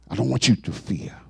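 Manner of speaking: repeated quietly, tender; hushed reassurance. Acted. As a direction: drop it to a soft, low hush. Repeated softer as God.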